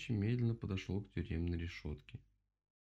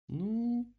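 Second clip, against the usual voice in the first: long, high, louder drawl; drawn out; 13 semitones higher, 5 dB louder than usual. A narrator's reading. Owl answering the rabbit's offer of help, drawn-out word. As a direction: stretch the word out, pitched up. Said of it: "протянула сова".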